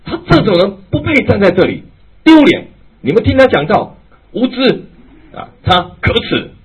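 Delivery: loud, high, furious public denunciation; angry heckler. Retold or imitated. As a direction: shout him down in front of everyone. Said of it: "忽然之间就冒出来说". (愤怒地当众高声指责)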